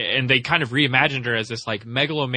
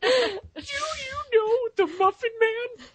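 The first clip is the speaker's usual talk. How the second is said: very high, slow, squeaky voice; Muffin Man interrogation; 4 dB quieter, much slower than usual. Acted. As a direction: draw it out like a tiny cookie under questioning. Acting out the Muffin Man scene.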